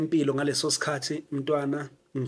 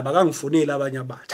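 usual speaking voice

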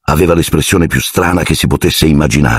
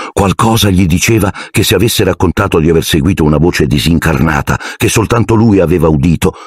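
ordinary delivery, nothing marked

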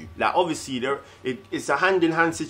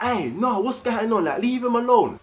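worried mum; 7 semitones higher than usual. Acted. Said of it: "a mum to be like".

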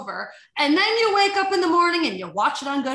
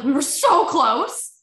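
stunned lament; 5 semitones higher, 7 dB louder, slower than usual. Retold or imitated. Shock after the miss.

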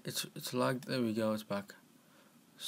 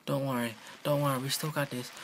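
ordinary talk, nothing marked

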